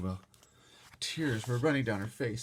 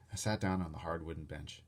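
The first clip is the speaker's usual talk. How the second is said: ordinary delivery, nothing marked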